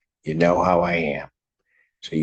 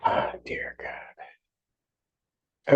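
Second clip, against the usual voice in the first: whispered disbelief; 8 dB quieter, much slower than usual. Real, not acted. Judge reacts in disbelief.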